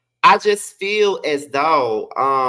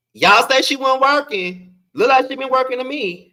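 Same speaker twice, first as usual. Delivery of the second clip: sassy; 10 semitones higher than usual. Pretend. Sassy retort to critics.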